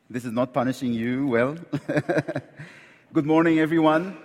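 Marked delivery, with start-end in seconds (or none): none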